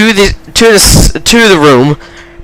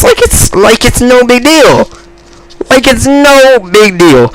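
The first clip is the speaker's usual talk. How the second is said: incredulous; 7 semitones higher than usual. Real, not acted. Storyteller amazed at his friend's casualness, high incredulous emphasis. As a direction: pitch it up and stretch the NO.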